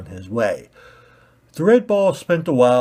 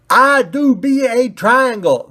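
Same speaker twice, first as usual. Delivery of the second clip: screamed; 13 semitones higher, 9 dB louder than usual. Acted. Pointy ball screaming at red ball, high and loud. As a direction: scream it in outrage.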